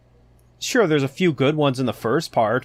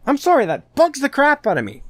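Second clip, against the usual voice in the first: higher and a bit louder, still fuming; half-apologize while still riled up; still worked up; 12 semitones higher, 4 dB louder than usual. Real, not acted. Still ranting, then apologizing.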